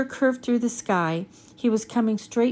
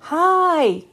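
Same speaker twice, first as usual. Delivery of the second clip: high, loud call; 7 semitones higher, 7 dB louder than usual. A narrator's reading. Girl calling down from the treehouse, loud. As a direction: call down to the creature. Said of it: "Annie called again".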